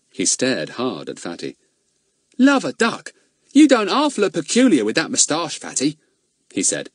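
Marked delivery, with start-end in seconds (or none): 2.4-6.0 higher, louder Cockney lad teasing; cheeky boy Ern. Acted. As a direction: gawp at Fatty and blurt it out. Ern's voiced lines.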